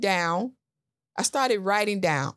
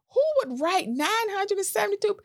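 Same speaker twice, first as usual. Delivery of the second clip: incredulous; 12 semitones higher, faster than usual. Retold or imitated. Retold own disbelief at book.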